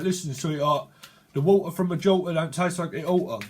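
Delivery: broad Cockney with dropped t's; hammed-up Cockney geezer. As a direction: lay on the Cockney patter. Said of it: "a bit of Cockney slang".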